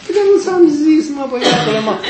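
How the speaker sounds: hammed-up teacher scolding a pupil; exasperated teacher. Teacher scolding the boy who forgot, laughing. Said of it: "ca un profesor care spune".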